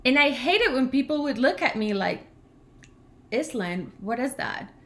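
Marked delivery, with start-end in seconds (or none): none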